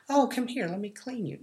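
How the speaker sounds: high, soft sugary coaxing; sweet mock coaxing. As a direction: coo at it like a pet. Mocking sweet voice to dog.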